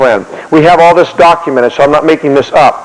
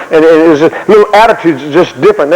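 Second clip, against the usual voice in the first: ordinary delivery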